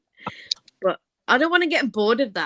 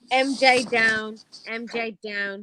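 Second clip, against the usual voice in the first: slow solemn announcement; much slower than usual. Acted. Mock announcement of MJ down.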